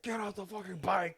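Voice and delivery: high, loud retold freak-out; a half-laughing mock yell at a biker. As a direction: yell it like a panicked cop losing it. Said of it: "he starts freaking out".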